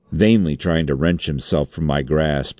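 ordinary delivery, nothing marked

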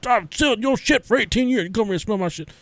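high-pitched movie dad impression; dad from Friday. Dad on the toilet to his son, comic high-voiced impression. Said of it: "reminds me of the dad from, uh, Friday".

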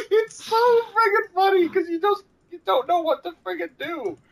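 high, laughing words; laughing while talking. Real, not acted. Still cracking up over the edit, laughing speech. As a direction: push the words through laughter.

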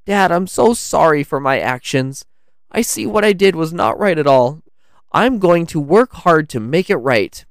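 put-on high, pleading child voice; mildly tearful apology. A narrator's reading. Lance apologizes to his dad, mildly tearful. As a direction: plead with a touch of crying. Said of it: "he cried".

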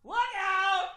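high sportscaster call; mock announcer shouting a warning; loud announcer. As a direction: call it out across the room like a play-by-play man. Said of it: "The fucking announcer".

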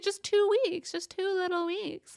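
high coaxing mock voice; sing-song it high like a sly bargainer; high wheedling imitation of him; wheedling mimicry. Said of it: "he's like".

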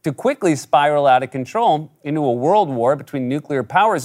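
ordinary talk, nothing marked

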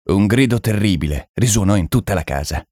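usual speaking voice

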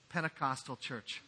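ordinary talk

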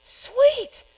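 high, loud and delighted; a burst of eager delight; mock excited. Disciples answering Jesus, all in, excited cry. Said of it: "they're like".

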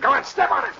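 high, frantic yell to the driver; urgent shout. Acted. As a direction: shout at him to floor it. Fleeing as uncle shoots.

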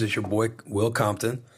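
ordinary talk, nothing marked

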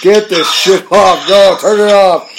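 real protest, rising to a shout; real disgust, shouted. Real, not acted. Reacting to a clip played to him.

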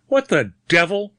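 surprised growl. A narrator's reading. Stranger meeting a gun at the door, surprised growl. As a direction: blurt it out, taken aback. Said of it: "growled the surprised voice".

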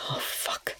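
whispered curse on the breath. A narrator's reading. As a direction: let it out on a breath, almost silent. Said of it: "Rush breathed".

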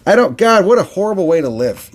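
real laugh mid-rant. Real, not acted. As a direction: crack up at it. Laughing at the idea.

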